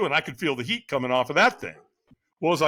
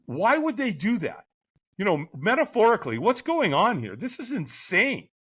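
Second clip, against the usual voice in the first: higher-pitched mock disbelief; incredulous questioning; 7 semitones higher than usual. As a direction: question it as utter madness. Retold brain reacting in disbelief.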